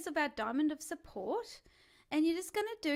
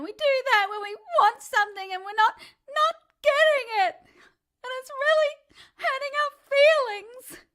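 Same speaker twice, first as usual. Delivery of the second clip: loud, high crying whine through words; whining speech; 11 semitones higher, 11 dB louder than usual. Shown as a demonstration. Coach explaining the whine while whining loudly. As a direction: whine the whole sentence. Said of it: "that's the whine".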